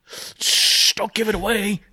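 long shush, then high-pitched plea; high, jokey hushed warning; playful shushing. Pretend. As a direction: hush them in mock secrecy. Playfully hushing a co-host's prediction.

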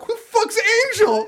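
high laughing mock friend; mock Annie. Friend asks who Angel is, high mock voice.

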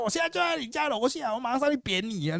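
very high-pitched mock threat; high, rushing mock tirade; retold scolding threat. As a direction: threaten a beating, imitated and hammed up. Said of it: "你怎么突然在那边骂人这样".

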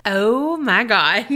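slow, drawn-out groan of dismay; drawn-out dread. Real, not acted. Co-host learns the dreaded R word is coming, drawn-out dread. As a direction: dread it, stretching each word out slowly.

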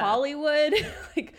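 loud laughing exclamation. Real, not acted. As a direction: burst out laughing on "Hollywood".

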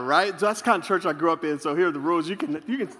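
ordinary talk

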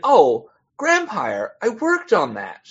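high, drawn-out "oh, I know it" voice; imitated convention stranger. Acting out convention stranger's reaction.

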